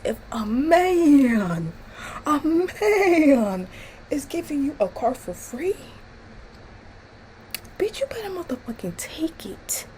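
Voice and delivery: rising to a high breathy hiss at the end; building from laughing disbelief to a hushed jab; incredulous rant. Real, not acted. Ranting at Chardonnay through the screen, high hissed disbelief.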